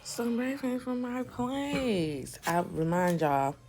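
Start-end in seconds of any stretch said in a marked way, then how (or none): none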